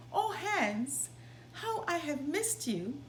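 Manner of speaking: tender high greeting of a mother; lightly voiced loving mother, welcoming her son; mother's greeting. A narrator's reading. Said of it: "said his mother".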